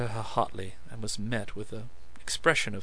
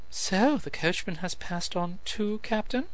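light, higher teasing voice rising on Captain; mocking question; 9 semitones higher than usual. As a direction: needle him with a lifted "Captain?". Lilith teases the captain.

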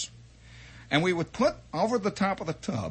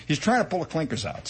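normal talking voice

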